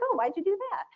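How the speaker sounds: soft, high, genuinely curious ask; gentle curious question. Pretend. Presenter to new coworker, softly curious. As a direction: ask it softly, wanting to understand. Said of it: "but instead I was like".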